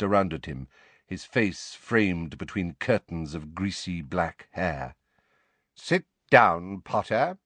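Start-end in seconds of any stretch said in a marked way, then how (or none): 5.8-7.4 lightly voiced stern teacher; curt command. A narrator's reading. Snape ordering Harry to a chair, stern. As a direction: order a pupil to sit, firmly.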